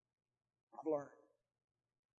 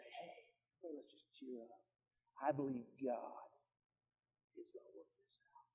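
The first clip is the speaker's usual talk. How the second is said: hushed encouragement; 11 dB quieter than usual. Pretend. Pastor voicing an encouraging call.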